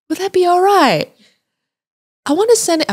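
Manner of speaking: high-pitched, badly faked Australian accent; terrible Australian accent. Woman on tour asking for a copy of the footage, bad Australian accent. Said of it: "That's a terrible Australian accent".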